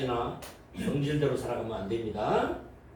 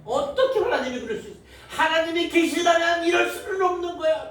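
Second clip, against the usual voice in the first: high, indignant whining at God; mock resentful complaint; 14 semitones higher, 4 dB louder than usual. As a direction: complain to God in hammed-up outrage. Acted complaining unbeliever.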